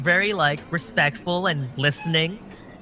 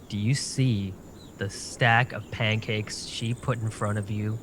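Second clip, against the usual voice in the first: hushed aside; 5 semitones lower, 7 dB quieter than usual. Acted. Character whispers to gnome friend.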